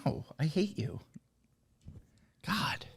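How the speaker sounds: breathy playful whisper. Real, not acted. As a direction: whisper it like ASMR. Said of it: "A S M R".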